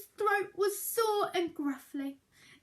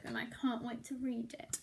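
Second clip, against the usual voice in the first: whisper; 4 semitones lower, 8 dB quieter, faster than usual. Real, not acted. Teacher's excited aside to class.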